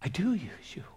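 soft whispered reassurance; hushed, tender whisper; little inner voice. Acted. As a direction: whisper it like a voice inside. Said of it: "this little voice says".

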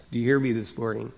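ordinary delivery

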